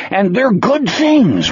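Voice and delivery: high, slowed, earnest character voice; Wolf, earnest. Acted. Voices Wolf, story figure.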